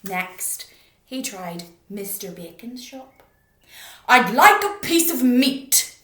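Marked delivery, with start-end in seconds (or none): 4.1-6.0 huge booming shout, far louder. Acted. Mister Noisy booming at the butcher for meat. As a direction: boom the order at the butcher. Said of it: "he boomed".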